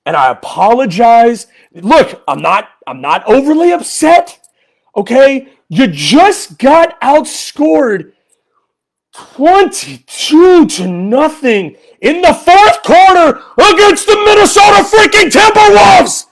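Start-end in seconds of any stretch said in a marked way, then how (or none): 5.7-16.3 ranting fan building to a roar; building angry shout. Real, not acted. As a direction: build from disbelief to a yell. Angry post-game rant.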